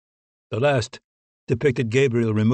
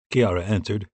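ordinary delivery

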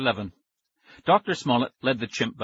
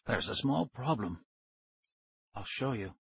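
hushed scientist; 9 dB quieter than usual. A narrator's reading. Scientist reveals witness to ghost children, hushed.

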